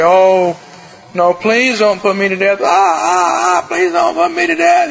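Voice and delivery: a voice cracking upward in fake panic; imitating fearful pleading. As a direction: play a condemned man begging for his life. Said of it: "somebody crying in fear".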